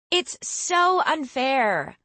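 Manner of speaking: high, slow pouting whine; whiny. Acted. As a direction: sulk it out long and high. Becky pouting over the vest.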